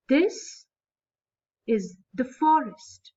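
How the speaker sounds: dactylic reading, beat on THIS; stress on THIS. Shown as a demonstration. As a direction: hit "This", pause, then carry on slowly. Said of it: "first one is stressed".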